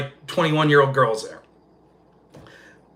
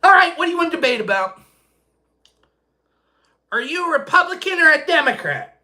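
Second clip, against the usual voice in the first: high, fast, impatient mimicry; quick high mock voice; mock exasperation; 12 semitones higher than usual. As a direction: rattle it off like a pushy questioner. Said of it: "I'm like".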